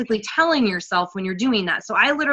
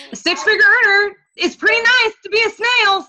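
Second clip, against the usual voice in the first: high, bright sales-pitch voice, louder and up; playful excited pitch; 9 semitones higher, 4 dB louder than usual. Acted. Coach inviting listeners to join her, playfully excited. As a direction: sell the snail life with a grin.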